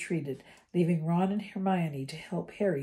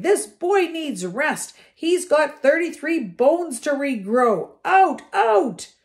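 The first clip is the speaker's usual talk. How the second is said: high, slow, loud scolding nurse; a stern matron shouting everyone out; 10 semitones higher, 11 dB louder, slower than usual. A narrator's reading. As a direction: shoo them out at full volume.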